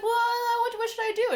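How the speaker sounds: high-pitched, stammered plea; mock flustered. He asks her for marketing advice, mock flustered. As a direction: ask helplessly in an exaggerated high voice. Acts out clueless man asking advice.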